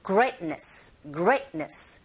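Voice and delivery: "Greatness" punched on GRATE, t nearly silent; stressed GREAT, silent T. Shown as a demonstration. Teacher drills learners, "Greatness" stressed first with unreleased t. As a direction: hit the start of "Greatness", hold back the t. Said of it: "거기에 힘을 꽝 주시고".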